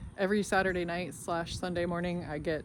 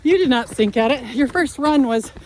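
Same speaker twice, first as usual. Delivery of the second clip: while running; 5 semitones higher, 13 dB louder than usual. Real, not acted. Mid-run update.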